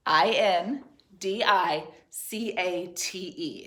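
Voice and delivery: slow, letter-by-letter spelling; spelling aloud. Shown as a demonstration. Teacher checking the third graders' spelling, spelling aloud. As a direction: spell it out with small gaps between groups. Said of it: "is the correct spelling".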